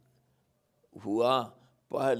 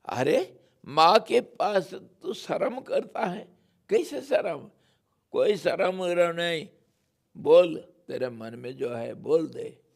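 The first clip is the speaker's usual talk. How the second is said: higher, louder scolding mother; mock scolding; 4 semitones higher, 5 dB louder than usual. Yashoda scolds Kanha for his shyness, higher and louder. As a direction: scold the child for being shy. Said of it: "डांटती है".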